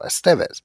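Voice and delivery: Estevez with emphasis on TEV; second-syllable stress. Shown as a demonstration. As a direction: demonstrate es-TEV-ez, hitting the middle syllable. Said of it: "emphasis on the second syllable".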